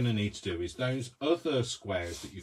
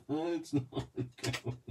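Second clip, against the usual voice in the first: words through stifled giggles; trying not to laugh; breaking character giggling; 5 semitones higher, 5 dB louder than usual. Real, not acted. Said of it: "I'm, I'm corpsing".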